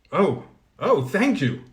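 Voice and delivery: high, eager thanks; overjoyed cry of thanks, pitched high; cried out. A narrator's reading. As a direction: burst out with grateful joy. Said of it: "cried Colin".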